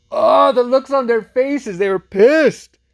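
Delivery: loud, high, drawn-out relish; gleeful exclamation. Real, not acted. Vlogger relishes the couple's angry looks, gleefully exclaiming. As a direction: crow over it, stretching the words high and loud.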